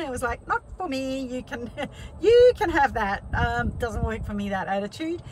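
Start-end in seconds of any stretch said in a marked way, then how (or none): 0.5-3.2 breezy refusal that breaks into a laugh; laughing, much louder on the laugh. Retold or imitated. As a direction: wave it away with a chuckle. Declining negativity, laughing it off.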